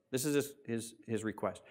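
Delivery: ordinary talk, nothing marked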